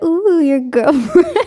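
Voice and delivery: high, slow, teasing "ooh"; drawn-out mock taunt; teasing singsong. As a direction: tease him about his crush. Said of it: "I'd be like".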